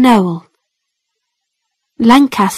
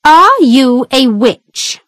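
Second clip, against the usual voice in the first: stern judge; 3 semitones higher, slower than usual. A narrator's reading. Judge questions accused witch.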